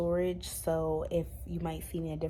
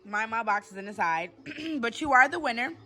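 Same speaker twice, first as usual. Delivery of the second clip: loud, excited hostess running a live draw; live giveaway host; 4 semitones higher, 8 dB louder, slower than usual. Acted. Vlogger to her viewers during the prize draw, loud and excited. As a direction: hype the viewers through the spin.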